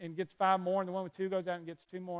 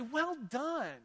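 higher, delighted praise from God; God congratulating a spent believer, higher and delighted; God's praise; 6 semitones higher, slower than usual. Pretend. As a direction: cheer them on like a proud God. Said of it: "don't you want to hear God say".

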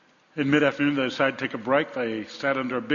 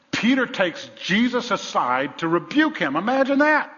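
full-force preaching; 8 semitones higher, 6 dB louder than usual. Real, not acted. Preacher astonished that Peter rebukes Jesus, full-force preaching. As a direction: build to a raised exclamation.

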